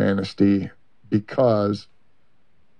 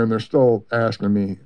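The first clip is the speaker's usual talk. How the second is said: ordinary talk, nothing marked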